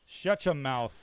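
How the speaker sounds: loud barked command at the prisoner; guard snapping. Acted. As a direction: shut the prisoner up. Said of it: "he says".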